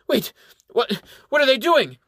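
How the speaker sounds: high, loud, panicked babble in a strained voice; a captive monk losing his nerve on the altar; blubbering. A narrator's reading. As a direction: blubber in sudden terror. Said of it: "he blubbers to himself".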